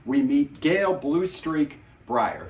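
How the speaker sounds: usual speaking voice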